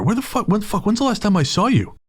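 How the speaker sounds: high, eager mock disbelief; excited stranger. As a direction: act thrilled to see old friends. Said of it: "he goes".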